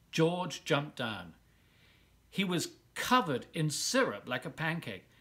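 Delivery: ordinary delivery, nothing marked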